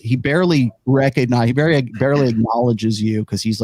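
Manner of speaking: usual speaking voice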